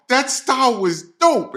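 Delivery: high, hyped-up insistence; imitating excited friend. Bandmate insists the performance was great, imitating excited friend. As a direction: pitch it up with admiring excitement. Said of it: "And Chris was all excited".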